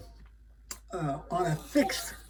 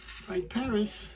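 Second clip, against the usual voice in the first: dramatic name; 5 semitones higher, much slower than usual. Acted. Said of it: "I'm gonna say it".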